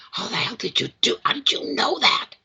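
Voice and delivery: high, incredulous demand; mock-indignant sister, pitch shooting up; imitated startled sister. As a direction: climb sharply into astonished questioning. Startled sister hits her, demands explanation.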